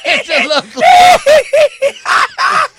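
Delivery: high squealing laugh through the words. Real, not acted. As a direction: crack up while saying it. Repeats punchline laughing.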